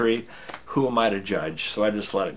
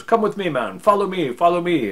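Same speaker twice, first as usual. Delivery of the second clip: acted urgent call, higher pitched and louder; beckoning shout, played in a story; tour guide calling; 8 semitones higher, 4 dB louder than usual. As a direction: call them along as an eager guide. Retold guide calling out to follow.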